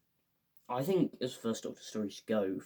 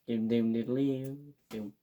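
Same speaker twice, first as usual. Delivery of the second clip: slow, drawn out fight sound effects; imitated sword fight noises; slower than usual. Action film sword fight, slow imitated clang noises. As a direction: hum the clash of blades slowly.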